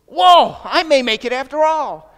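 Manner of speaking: a sudden high, loud whoa of relief; excited little bird. Acted. Bird thawing out in the manure, shouting with high, loud joy. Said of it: "He says".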